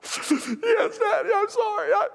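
put-on very high child wail with gasps; mock sobbing. Daughter confessing the lie to daddy, imitated sobbing. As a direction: confess through hammed-up sobs. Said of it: "one of those cries you couldn't stop crying".

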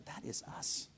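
whispered aside, hushed. Retold or imitated. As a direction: say it under your breath as if confiding. Quoting what he told God.

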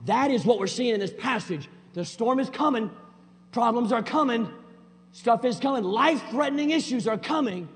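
loud, high, driving preacher voice; preaching at full force. Acted. Pastor driving the point home to church, loud preaching. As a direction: pound it out from the pulpit.

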